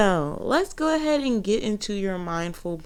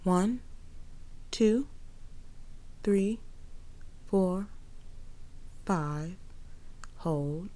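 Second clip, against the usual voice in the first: slow, soft breath counting; 2 semitones lower, 12 dB quieter, much slower than usual. Acted. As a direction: count the breaths slowly and softly.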